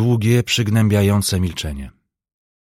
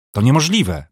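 loud, higher cry of disbelief; shocked shout; 5 semitones higher, 4 dB louder than usual. A narrator's reading. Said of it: "krzyknął Pomian".

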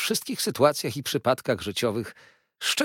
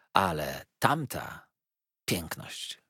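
slow, falling away soft; admiring awe; 4 semitones lower, 3 dB quieter, slower than usual. A narrator's reading. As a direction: linger on it, then hush in admiration. Awed description of the beauty. (powoli, ciszej, z zachwytem)

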